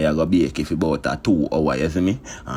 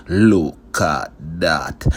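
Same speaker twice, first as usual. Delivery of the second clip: slow catchphrase; much slower than usual. Acted. Cook showing viewers the dish, slow proud catchphrase. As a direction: stretch every word slowly, showing off the dish.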